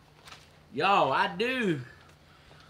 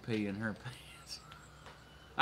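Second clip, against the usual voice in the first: losing it on the last words; breaking into laughter; 5 semitones lower, 12 dB quieter, much faster than usual. Real, not acted. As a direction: voice fading into a real laugh. Cracks up telling viewer's comment.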